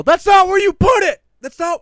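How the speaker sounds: full-volume, high-pitched retold scream. Shopper correcting family member at the card holder, screaming. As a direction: yell it out in exasperation. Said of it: "I probably shouldn't scream".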